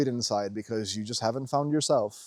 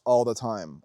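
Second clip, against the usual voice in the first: ordinary delivery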